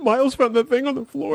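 high, gushing fan voice. Retold or imitated. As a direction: swoon over the scene. Gushing about favourite scene.

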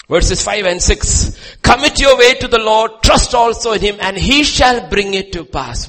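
high, loud shouted sermon; fiery pulpit proclamation; preaching at full force. Real, not acted. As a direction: thunder the psalm to the congregation. Loudest preaching of psalm verses.

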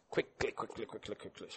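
hushed, rapid "quickly, quickly"; whispered urging. Real, not acted. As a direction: whisper at the crowd to hurry. Urging congregation to find verse.

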